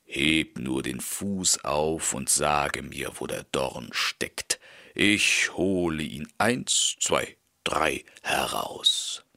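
low, reassuring wolf voice; wolf playing doctor. A narrator's reading. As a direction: coax the horse to lift its hoof.

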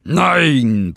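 loud, high roared refusal; furious lion bellowing back; angry roar. A narrator's reading. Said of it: "brüllte der Löwe zornig zurück".

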